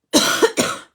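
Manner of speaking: breathy unvoiced cough; harsh cough, then excuse yourself; coughing fit. Real, not acted. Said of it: "Pardon me".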